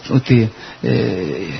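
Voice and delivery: usual speaking voice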